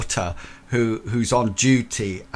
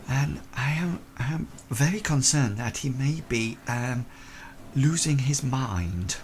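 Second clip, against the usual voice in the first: slightly raised, halting lady's voice; concerned Victorian lady; 3 semitones higher than usual. Acted. Lady confiding fears about her fiancé, mildly anxious. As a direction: confide a worry delicately, haltingly. Game master plays worried Miss Lawrence.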